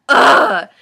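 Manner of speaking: sudden loud breathy ugh; loud disgusted groan. Real, not acted. Vlogger groans at the Mary Sue name, loud. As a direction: spit out your disgust.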